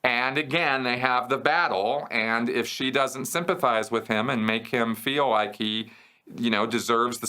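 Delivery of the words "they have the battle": normal talking voice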